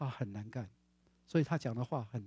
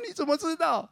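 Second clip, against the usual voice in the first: surprised question; 12 semitones higher, 6 dB louder than usual. Retold or imitated. 讲道者惊问.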